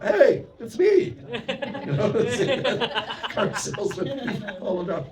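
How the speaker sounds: high, slow laughing speech. Real, not acted. Monk laughing as he recalls the dealer's call, high.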